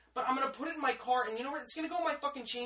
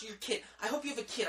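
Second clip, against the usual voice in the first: ordinary talk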